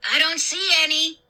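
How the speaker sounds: mild call to someone outside. A narrator's reading. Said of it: "Luke called out to me".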